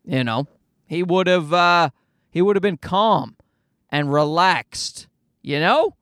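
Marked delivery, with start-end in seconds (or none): none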